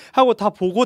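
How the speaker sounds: ordinary delivery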